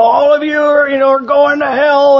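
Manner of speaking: hammed-up doom-shouting fanatic; mock street preacher. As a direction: imitate a wild-eyed street-corner preacher. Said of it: "wild-eyed religious fanatic ... with a megaphone".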